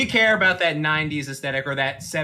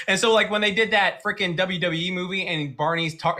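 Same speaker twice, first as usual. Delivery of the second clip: usual speaking voice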